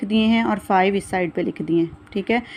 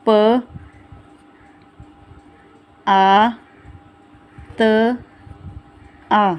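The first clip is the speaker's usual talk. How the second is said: spelling out; much slower than usual. Shown as a demonstration. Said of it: "इसके तोड़ हमारे पास क्या बनेंगे". (अक्षर ज़ोर से एक-एक करके)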